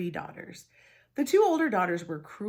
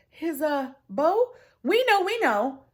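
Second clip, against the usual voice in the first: much higher, loud, panicky insisting; flustered bluff; 9 semitones higher, 8 dB louder than usual. Acted. Sisters bluffing in panic, much higher and louder. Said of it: "stammered in surprise".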